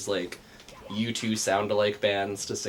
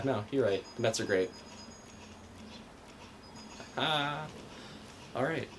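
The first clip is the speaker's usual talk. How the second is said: ordinary talk, nothing marked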